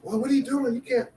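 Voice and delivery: high-pitched mock freak-out; retold panicked uncles. Two uncles reacting to the boy's new car, mock panic. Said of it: "they go crazy".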